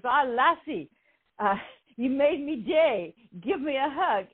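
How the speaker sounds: a playful hint of a Scottish security guard; Scottish accent. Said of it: "he's Scottish".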